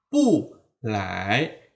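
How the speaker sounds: "Pu lai" with Pu falling sharply, lai rising; fourth-tone pu. Shown as a demonstration. Teacher keeps pu in fourth tone before lai, falling. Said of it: "tetap dibunyikan dengan nada keempat".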